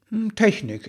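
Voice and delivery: clipped one-word answer, dismissive; curt. A narrator's reading. Elf woman brushes off his question, curt. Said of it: "erklärte sie kurz".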